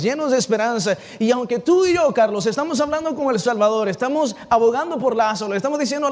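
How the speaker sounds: ordinary delivery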